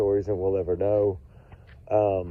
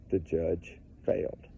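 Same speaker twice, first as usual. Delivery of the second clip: low, soft and slow, letting it sink in; quiet grave statement; 4 semitones lower, 8 dB quieter than usual. Shown as a demonstration. Telling viewers the judge failed, quiet and slow. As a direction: say it quietly and slowly, like a verdict. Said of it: "That's important".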